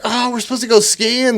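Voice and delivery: high-pitched mock plea; pleading whine. Pleading with cops before arrest, high whine. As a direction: whine it way up, imitating your own protest.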